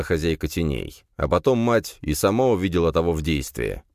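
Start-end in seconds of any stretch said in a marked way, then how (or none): none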